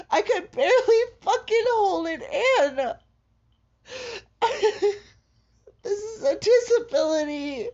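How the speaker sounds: real tears breaking through bitter laughs; crying through laughter. Real, not acted. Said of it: "what you're listening to right now is an episode".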